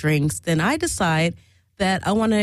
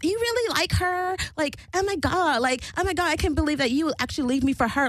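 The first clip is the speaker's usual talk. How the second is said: high-pitched mimicked disbelief; imitating jealous woman; 8 semitones higher than usual. Mary in her ear about the dancer, high mock-jealous disbelief. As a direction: put on her high, incredulous voice.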